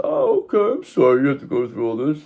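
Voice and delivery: high, exaggerated fake apology; mock sympathy. Replying to people who did wrong, high mock sympathy. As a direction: swoop up high in pretend concern.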